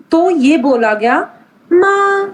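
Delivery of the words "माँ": माँ on a high, level, held note; first tone. Shown as a demonstration. Teacher demonstrating a Chinese tone to students, first tone. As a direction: hold माँ steady at one high pitch. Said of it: "जब constant tone लगी".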